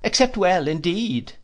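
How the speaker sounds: put-on high, fluttery elderly mother; fussy old lady. A narrator's reading. Old lady voiced in story.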